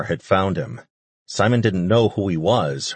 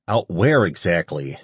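plain reading voice

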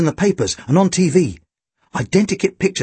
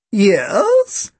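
put-on old woman's querying voice, pitched up; elderly landlady; 5 semitones higher than usual. A narrator's reading. Landlady answering the guest house door, elderly querying voice. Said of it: "elderly woman in a grey cardigan".

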